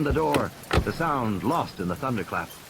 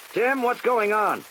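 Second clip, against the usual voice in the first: higher, slower anxious call; worried man on radio; 10 semitones higher, slower than usual. Acted. Doctor Grant calls Tim over the radio, worried. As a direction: ask urgently over the radio. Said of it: "Doctor Grant on the radio".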